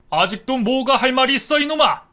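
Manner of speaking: loud, high furious scolding; a father roaring at his lying son. A narrator's reading. Father scolding his son for lying, furious loud shouting. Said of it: "아버지가 아주 많이 화가 나셨답니다".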